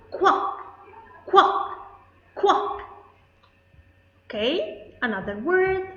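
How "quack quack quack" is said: loud quacking like a duck; "Quack" said like a duck calling, louder. Shown as a demonstration. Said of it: "the sound of the duck".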